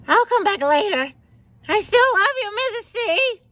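high, loud parting call. Acted. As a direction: holler it fondly on the way out. Calling out on the way out.

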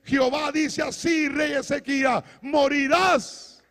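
high, forceful prophetic proclamation, the last word stretched out; prophet pronouncing doom. Acted. Prophet delivering God's verdict to the sick king, forceful proclamation. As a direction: proclaim a death sentence to a king. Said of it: "Y viene el profeta, le dice".

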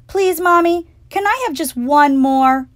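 put-on high, loud pleading child; begging. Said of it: "I begged".

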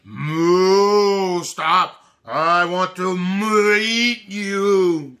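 low, slow mooing cow voice; hungry cow calling out to the ninja bread man; cow crying out. Acted. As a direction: moo the words like a hungry cow. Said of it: "the cow cried".

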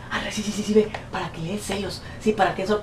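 whisper-soft conspiratorial parody; hushed mocking imitation; mocking women. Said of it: "personas que hacen eso".

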